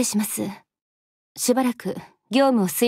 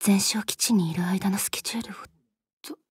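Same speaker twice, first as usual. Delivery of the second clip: soft whispered reading of the schedule; 3 semitones lower, 5 dB quieter than usual. Acted. Attendant whispers the outpost schedule to herself. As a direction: murmur it under your breath.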